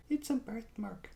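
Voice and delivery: high, whispery put-on voice; mimicked film character. Family member explaining the tattoo to Jax, whispery high mimicry. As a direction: mimic it small, high and soft.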